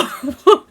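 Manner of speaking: short loud rising laugh; a quick loud giggle. Real, not acted. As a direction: let a giggle escape. Laughing at own emotion.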